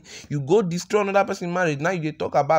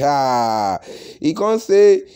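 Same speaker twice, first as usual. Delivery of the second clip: ordinary delivery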